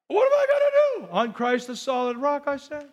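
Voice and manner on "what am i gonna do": much higher, fast panicked squeal, now laughing at it; high comic panic again; mock panic. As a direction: repeat the frantic worrier's cry. Repeats the panicked cry.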